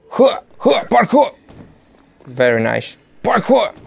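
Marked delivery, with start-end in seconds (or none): 0.1-1.3 high, punchy parkour yelps; mock parkour cry. Player calling out his own game jumps, high mock-heroic cry. 3.2-3.7 high, punchy parkour yelps; mock parkour cry. Player calling out his own game jumps, high mock-heroic cry.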